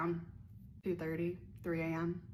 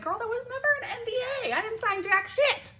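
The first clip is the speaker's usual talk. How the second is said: much higher, louder sassy clapback; sassy retort; 7 dB louder than usual. Real, not acted. Answering commenters, she gives a high, loud sassy retort. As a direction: clap back with attitude. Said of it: "And it's like".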